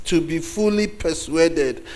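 ordinary delivery

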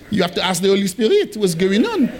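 comic put-on French accent. Shown as a demonstration. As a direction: joke along in a thick French accent. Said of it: "talking with a French accent".

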